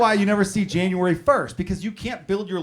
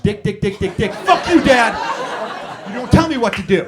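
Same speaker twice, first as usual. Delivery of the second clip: put-on loud, higher-pitched teen outburst; hammed-up teenage defiance at dad; defiant teenage daughter; 5 semitones higher, 8 dB louder than usual. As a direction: act out a rebellious daughter, building to a yell.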